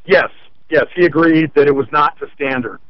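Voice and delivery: ordinary talk, nothing marked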